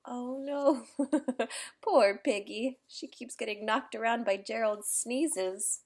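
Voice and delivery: usual speaking voice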